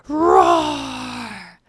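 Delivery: drawn-out, high storybook roar; long acted lion cry; lion's roar. A narrator's reading. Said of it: "shouted the lion throughout the forest".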